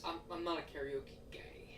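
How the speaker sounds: whispered aside. Real, not acted. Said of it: "whispered aside".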